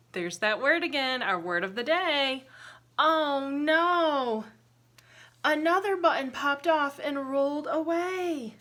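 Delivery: plain reading voice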